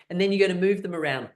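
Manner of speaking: ordinary delivery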